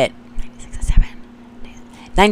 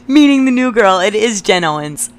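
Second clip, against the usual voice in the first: real laughter; 4 semitones higher than usual. Real, not acted. Reading an old middle school note, laughing hard. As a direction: laugh helplessly through the line.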